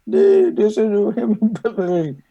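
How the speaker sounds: laughs through it, voice jumping up; amused, breaking into a laugh mid-line. Real, not acted. Telling a friend women hear him fine, laughing.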